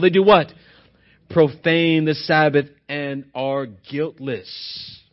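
loud, low-pitched reading from the pulpit; scripture reading. Real, not acted. Preacher reading Matthew chapter twelve aloud, loud scripture reading. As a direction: read it out firmly to the congregation.

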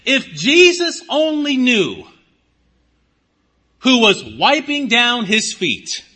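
preacher voicing Simon's scorn at the weeping woman; scornful Pharisee. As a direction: raise the voice in prim disgust. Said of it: "And he says".

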